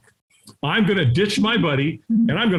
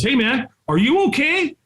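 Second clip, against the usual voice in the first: high-pitched, friendly checking-in; imitated concerned stranger; 9 semitones higher than usual. Firewalk helper to man hiding in back, mock concern. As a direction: call over to someone acting oddly.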